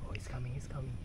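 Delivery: soft hushed whisper as the cat comes closer; excited whisper. Real, not acted. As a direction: whisper it so you don't scare him. Cat approaching.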